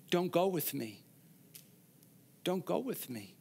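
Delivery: softly pleading, almost under the breath. Acted. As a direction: beg them gently not to follow. Naomi pleading daughters to leave.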